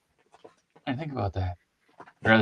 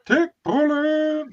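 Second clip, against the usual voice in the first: slow, much higher mock repetition; amused echo; 3 dB louder, much slower than usual. Real, not acted. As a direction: savour the silly phrase. Echoing a silly phrase.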